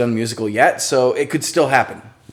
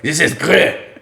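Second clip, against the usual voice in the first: put-on terrible French accent; 3 semitones higher, slower than usual. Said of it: "terrible French accent".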